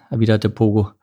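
usual speaking voice

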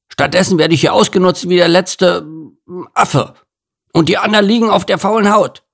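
fed-up gorilla complaining, speeding up; indignant gorilla. Acted. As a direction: rant at being used. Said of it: "grummelte Gerhard".

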